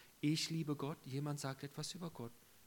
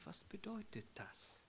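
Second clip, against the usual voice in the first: hushed, wondering whisper; soft, unvoiced puzzled whisper; 6 semitones higher, 11 dB quieter than usual. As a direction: ask it under your breath. Quoting own puzzled inner question.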